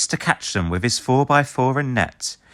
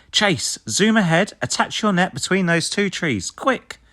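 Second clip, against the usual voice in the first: much higher, urgent command; urgent order; 9 semitones higher than usual. A narrator's reading. Ryder ordering Chase, higher and urgent. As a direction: hurry the pup along. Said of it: "says Ryder".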